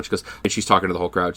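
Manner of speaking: ordinary talk, nothing marked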